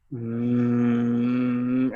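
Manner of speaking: long, low hum on the exhale; humming demo. Shown as a demonstration. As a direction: sustain a deep "mmm". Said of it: "hum on that exhale".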